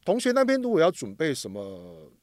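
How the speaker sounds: ordinary talk, nothing marked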